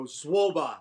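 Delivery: brusque Mandarin brush-off, waving them away; dismissive get out. Retold or imitated. Dismissing the Chinese repairmen at the front door, brusque. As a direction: Zwoba snapped like get out of here. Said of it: "saying it that tone is like, "Get out of here".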